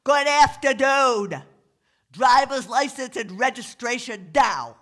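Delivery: stuffed-up New York bellow, high; loud New York cop shout. Acted. Cop at the rolled-up window, shouting as loudly as possible. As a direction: shout to break through the glass. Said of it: "shouts as loudly as possible".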